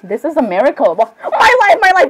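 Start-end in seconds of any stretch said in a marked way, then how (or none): 1.2-2.1 high-pitched gushing cry; excited outburst. Acted. Character overcome on seeing someone, excited. Acted fangirl outburst in roleplay.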